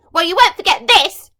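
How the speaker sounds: furious threat. Acted. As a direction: spit out the revenge. Furious villain threatens court.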